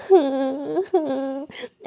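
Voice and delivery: long wailing sobs; acted loud sobbing. A narrator's reading. Girl crying into her pillow, wailing sobs. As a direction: break down into drawn-out sobs. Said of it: "ऐसे जोरों से रोने लगती है".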